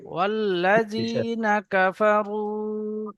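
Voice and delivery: slow melodic Quran chanting; Quran recitation. Shown as a demonstration. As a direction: chant it slowly, drawn out. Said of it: "teacher models the verse".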